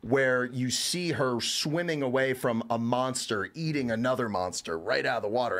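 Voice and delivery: ordinary talk, nothing marked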